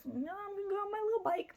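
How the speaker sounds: high, fast mock voice; squeaky put-on voice for the burglar; mocking Joseph. Narrator mocks Joseph sneaking off, high fast voice. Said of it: "He goes".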